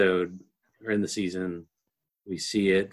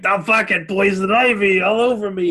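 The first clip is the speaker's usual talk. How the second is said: high, loud whiny Polly complaint; mock Polly; 9 semitones higher, 5 dB louder than usual. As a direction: whine it like an itchy gangster. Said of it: "fucking Polly is like".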